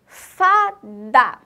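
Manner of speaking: "fada" loud, split into fa and da; syllable by syllable. Shown as a demonstration. Teacher teaching children to listen to word sounds, syllable by syllable. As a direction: sound out "fada" in two strong beats. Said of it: "aprender a escutar o som da palavra".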